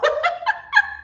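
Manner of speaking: very high squealing laugh. Real, not acted. Laughs about nowhere to go.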